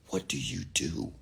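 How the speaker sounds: soft, slow, hushed question; very quiet. Retold or imitated. Gym guy quietly asking what he does for work, hushed. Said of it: "he said it very quietly".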